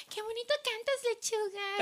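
high, soft imitation of fangirls; mock girly gushing; admiring girls. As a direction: coo it like a fawning girl, way up high. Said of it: "there's some girls like".

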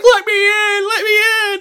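very high, loud wail; mock desperate yell. Rick Moranis banging on restaurant window, mock desperate yell. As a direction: plead at the top of your voice. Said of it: "banging on that fucking restaurant window".

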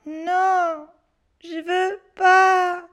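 put-on child whimpering no; whiny refusal. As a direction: drag it out like a frightened child. Scared boy refusing.

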